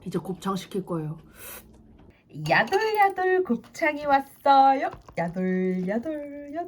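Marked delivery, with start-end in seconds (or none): 2.5-6.7 slow, sing-song and louder; playful announcing. Acted. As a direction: drawl out the food intro. Presenting delivered tripe to camera. (장난스럽게 길게 소개)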